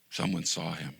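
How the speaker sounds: quiet echo. Acted. Pastor repeating the point to the congregation, hushed. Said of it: "and someone saw him".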